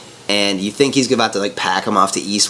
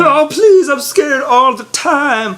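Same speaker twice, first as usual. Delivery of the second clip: squealing plea; much slower than usual. Retold or imitated. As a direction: beg in a mock squeal, way up high.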